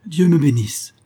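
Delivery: high, loud put-on astonishment; feigned surprise. A narrator's reading. Husband pretending to remember letters, loudly feigned surprise. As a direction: fake a sudden start of surprise. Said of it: "jouant à s'y méprendre la surprise".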